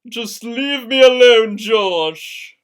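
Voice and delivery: loud angry dismissal. A narrator's reading. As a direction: push him away, hurt. Angry girlfriend ends argument.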